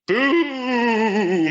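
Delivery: haunt the word boomer; spooky voice. Pretend. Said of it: "bonus points for doing a spooky voice".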